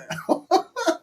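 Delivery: high delighted laugh. Real, not acted. Laughs at the drop.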